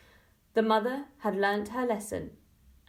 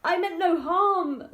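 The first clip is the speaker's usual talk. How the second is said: high, loud and slow plea; mother tearfully excuses herself to her daughter; 9 semitones higher, 5 dB louder, slower than usual. A narrator's reading. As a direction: plead through near tears. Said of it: "said the mother tearfully".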